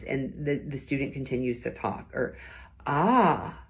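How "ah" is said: questioning ah. Shown as a demonstration. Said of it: "like a question".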